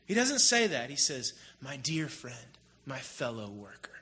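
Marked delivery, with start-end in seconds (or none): 1.6-3.9 soft tender greeting. Retold or imitated. Paul's tender greeting retold.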